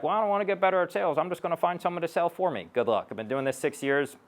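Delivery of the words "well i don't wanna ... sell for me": higher-pitched, rushed imitation of an excuse-maker; whiny objection, imitated quickly and higher; mocked reluctant listener. Sales coach imitates reluctant business owners, mocking and high-pitched. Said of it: "for those of you who are like".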